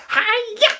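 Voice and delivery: high, sharp karate yell; karate cry. Acted. Girl doing karate moves at the fairy, high yell. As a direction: kick with a squeaky hi-ya. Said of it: "trying out some karate moves".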